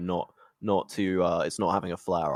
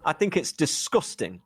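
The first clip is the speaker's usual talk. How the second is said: higher-pitched mock northern English accent; 7 semitones higher than usual. Shown as a demonstration. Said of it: "You gotta say it in a northern accent".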